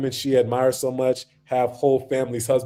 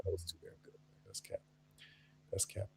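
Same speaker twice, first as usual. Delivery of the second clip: very quiet, low aside, almost under the breath; muttered admission; 7 semitones lower, 25 dB quieter, faster than usual. Real, not acted. Host admits he lied to viewers, muttering very quietly. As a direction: let it sink to a barely audible mumble.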